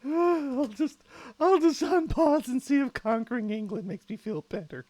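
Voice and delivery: high, loud words through leftover laughter; still laughing. Real, not acted. Streamer to chat deciding to conquer England, laughing.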